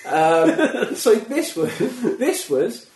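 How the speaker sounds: high, loud laughing. Real, not acted. Telling friend about the chainsaw audition, laughing. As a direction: laugh your way into the story.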